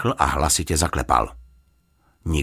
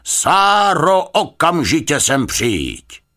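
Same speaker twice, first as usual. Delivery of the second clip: high, furious summoning shout; very loud, urgent command to come; loud calling; 13 semitones higher, 6 dB louder, much slower than usual. A narrator's reading. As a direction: shout for her, stretching the words. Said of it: "zavolal hlasitě".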